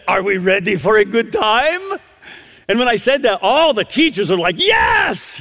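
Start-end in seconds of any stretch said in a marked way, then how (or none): 0.1-2.0 imitated Romanian grandmother, higher, question lifting; Romanian accent. Preacher quoting Rodica to the assembled teachers, Romanian accent. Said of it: "she says in this unique accent". 4.6-5.4 high-pitched mock cheer; crowd cheer. All the teachers answering the preacher, excited cheer.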